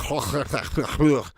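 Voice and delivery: mock throaty Welsh noises; guttural Welsh sounds. Streamer mimicking sounds of Welsh to viewers, guttural imitation. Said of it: "sounds going on there".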